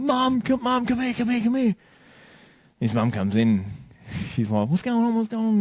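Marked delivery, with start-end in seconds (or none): 0.0-1.8 high-pitched, urgent mock call; frantic retold summons, pitched way up; calling for mum. Son calls his mum in panic. 4.7-5.6 high, worried mum impression; retold alarm in a high voice. Alarmed mum arrives in story.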